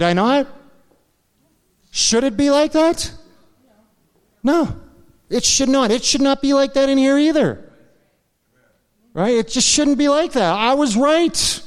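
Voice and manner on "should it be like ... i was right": higher, pressing preaching voice; rising preacher's fervour. Acted. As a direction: build the indignation up high. Preaching on his righteous anger.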